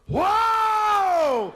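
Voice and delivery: long, high, loud wail; wife's labour howl. Retold or imitated. Wife howling from the back seat, long pained yell. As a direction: howl out the pain. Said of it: "My wife goes".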